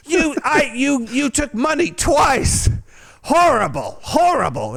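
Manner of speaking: put-on high, slow, indignant drunk wail; drunk outrage. Drunk customer accusing the stripper, high indignant wail. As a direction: whine it out like a wronged drunk.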